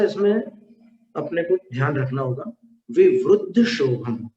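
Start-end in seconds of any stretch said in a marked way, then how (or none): 2.9-4.3 विवृद्ध as 'vivruddha', slow; u after the r in विवृद्ध; correct u pronunciation. Shown as a demonstration. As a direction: say विवृद्ध slowly with 'vru'. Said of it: "विवृद्ध शोभम नहीं".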